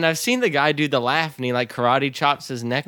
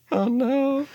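ordinary talk, nothing marked

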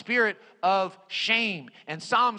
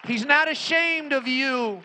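raised, ringing pulpit declaration; preaching cry; 6 semitones higher, 4 dB louder than usual. Real, not acted. Preacher assures the congregation about Jesus, preaching cry. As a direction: proclaim it out to the whole congregation. Sermon climax, then softer repeat.

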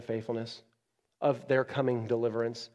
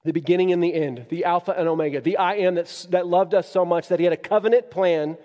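louder, higher, building proclamation; preaching climax; 5 semitones higher, 8 dB louder than usual. Real, not acted. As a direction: build it up like a rising praise. Preaching climax on God's names.

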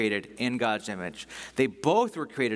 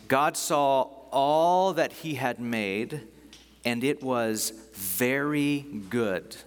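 very slow, spaced-out scripture reading; much slower than usual. Real, not acted. Preacher reading the last verse of creation, slowly. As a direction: draw out "all" and "very good". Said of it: "I wanna read this slowly".

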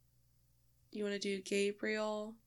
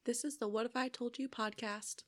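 ordinary talk

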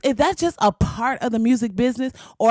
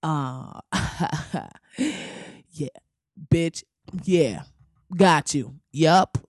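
lower, soft, laid-back drawl; smug low voice; 5 semitones lower, 3 dB quieter, much slower than usual. Retold or imitated. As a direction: savor it smugly. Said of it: "He was like".

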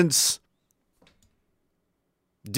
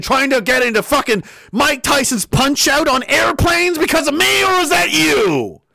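ranting shout; 7 semitones higher than usual. Real, not acted. Ranting at media.

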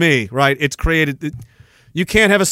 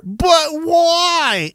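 put-on much higher whiny child voice; whiny baby voice; 12 semitones higher than usual. Baby Janice's first words, high and whiny. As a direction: whine it like a toddler. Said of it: "Its first fucking words were".

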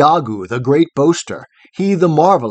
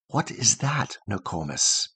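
put-on soft hushed child's question, dropping away; child asking grandmother softly at night; whispered question; 5 semitones lower, 9 dB quieter than usual. A narrator's reading. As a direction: ask it under your breath.

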